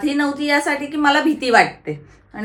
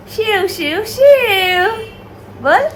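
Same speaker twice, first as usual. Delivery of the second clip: high, drawn-out playful name-calling; sing-song calling; 8 semitones higher, much slower than usual. Real, not acted. Aunt calls the toddler to get him talking, sing-song calling.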